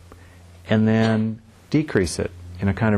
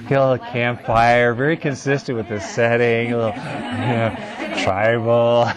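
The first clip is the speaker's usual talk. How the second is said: laughing; 3 semitones higher than usual. Real, not acted. Joking about campfire lighting.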